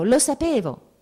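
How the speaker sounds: put-on a little boy repeating it happily; boy repeats his favourite phrase to mother; gleeful child. A narrator's reading. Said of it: "ripeteva lui la sua frase preferita".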